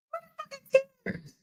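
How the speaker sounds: can't believe there are no tears; high strained incredulous question; stunned disbelief. Real, not acted. Reacting to tearless crying.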